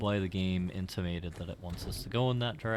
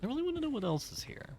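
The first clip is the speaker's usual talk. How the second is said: high squeaky voice; much faster than usual. Acted. Squeaky excited voice.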